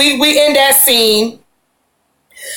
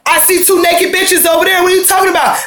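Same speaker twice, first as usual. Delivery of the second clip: mock angry yell; 5 semitones higher than usual. Boyfriend yelling at Tierra about the party, mock angry shout. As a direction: throw the accusation in her face.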